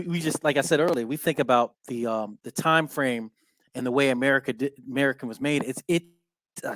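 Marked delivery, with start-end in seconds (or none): none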